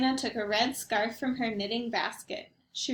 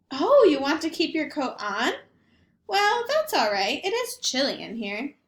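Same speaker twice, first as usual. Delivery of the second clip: put-on higher, sweet old lady voice; a cheerful, half-deaf grandmother greeting her guest; Baba Brena; 3 semitones higher, 4 dB louder than usual. Grandmother greeting the rabbi at her door, chirpy old lady voice.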